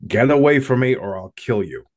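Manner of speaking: loud, high threatening yell; retold scream. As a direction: scream it at the thing to drive it off. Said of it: "as I screamed at this thing as loud as I could".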